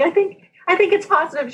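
high, quick, nervous stammer; shaking, hesitant. Retold or imitated. Showing the manager the test, shaking and hesitant. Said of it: "and I'm shaking".

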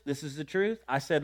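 ordinary delivery

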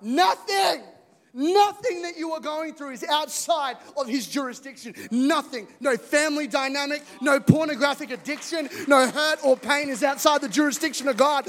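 preacher proclaiming loudly to the congregation; full-force preaching. Real, not acted. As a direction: preach it out to the church.

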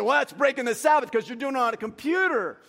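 high, loud mock scolding; retold yelling. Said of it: "but he's yelling at me".

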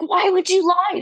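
high, baffled plea; exasperated why. Retold or imitated. Daughter questioning her mom, high and exasperated. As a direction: squeak up in disbelief.

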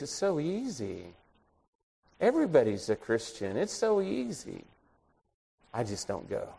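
soft, retold admission; subdued confession. Salesman admits he skips church, quietly subdued.

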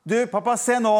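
put-on high, loud child calling for dad's attention. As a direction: shout "se nå!" like an eager little boy. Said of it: "da ropte jeg". (ivrig barn som roper høyt på pappa)

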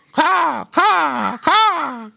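very high crow caws; crow cawing. A narrator's reading. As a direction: squawk like a crow. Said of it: "bobbing his head up and down, said".

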